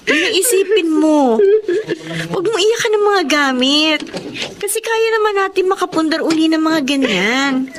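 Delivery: tearful consoling. Acted. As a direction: comfort her through your own tears. Child consoling crying mother.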